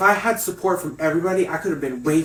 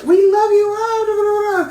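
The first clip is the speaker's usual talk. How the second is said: very high, loud squealed adoration; mock adoring crowd; 7 dB louder, slower than usual. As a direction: squeal like fans fawning over a drag queen. Acting out crowd adoring drag queens.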